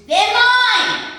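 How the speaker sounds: very high, very loud possessive shriek; sudden scream. Retold or imitated. Large woman with walker claiming the monkeys, sudden scream. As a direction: scream it out as if grabbing. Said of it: "suddenly she screams".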